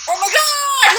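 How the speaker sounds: high, loud pretend gasp of delight; acting out the excited reaction to come; mock excited cry. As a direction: preview your own gushing. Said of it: "I'll be going".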